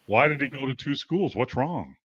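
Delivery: usual speaking voice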